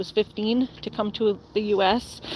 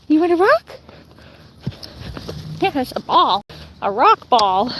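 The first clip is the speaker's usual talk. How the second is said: excited pet talk; 4 semitones higher than usual. Real, not acted. Talking to her husky, excited high baby talk. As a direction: gasp and get the dog excited about a rock.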